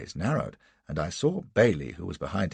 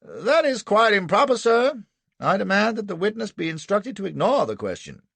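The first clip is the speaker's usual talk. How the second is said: much higher, louder indignant lawyer voice; defence counsel objecting; 11 semitones higher, 6 dB louder than usual. Acted. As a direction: rise up in righteous protest to the court. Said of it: "Anderson ... was on his feet".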